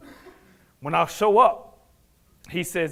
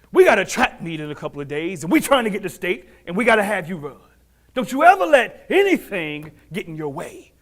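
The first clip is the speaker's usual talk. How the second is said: loud, raised exhortation building at the end; emphatic coach; 3 semitones higher, 5 dB louder than usual. Retold or imitated. Coach urging athlete before the track meet, forceful exhortation. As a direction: drive it home like a coach.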